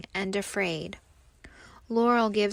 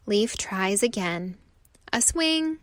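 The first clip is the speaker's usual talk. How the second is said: plain reading voice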